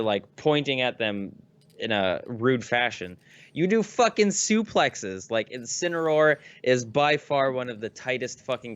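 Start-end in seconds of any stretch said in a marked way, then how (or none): 3.5-5.3 much higher, slow hyped call; excited. Real, not acted. Gamer hyping up his favorite fighter, excited and slow. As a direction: savor each word with hype.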